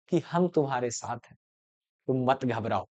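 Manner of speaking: soft, low and gentle, like a hushed promise; tender reassurance. A narrator's reading. Teacher voicing Vinod's silent message to Manjho, soft and tender. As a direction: quietly reassure her she is not alone.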